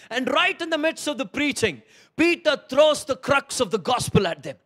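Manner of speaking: loud, high fervent preaching; rising, pounding sermon delivery; preaching at full force. Acted. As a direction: build it up like a revival preacher.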